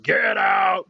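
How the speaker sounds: loud, booming shout. Retold or imitated. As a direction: boom it out like a giant voice. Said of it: "big booming voice".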